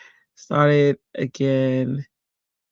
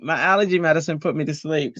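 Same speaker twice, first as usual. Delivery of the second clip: ordinary delivery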